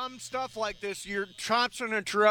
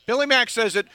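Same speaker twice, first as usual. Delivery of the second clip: normal talking voice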